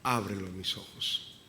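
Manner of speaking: soft, fading prayer to God; an intimate prayer that sinks to a murmur; quiet prayer. Real, not acted. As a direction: pray it low and pleading. Pastor prays aloud, fading.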